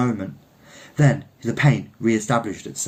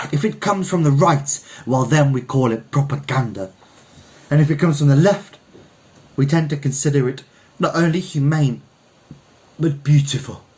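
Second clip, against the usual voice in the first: higher, louder declaiming Frenchman; French poet orator; 4 semitones higher, 3 dB louder than usual. Acted. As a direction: build the oration to a ringing close. Frenchman's speech building.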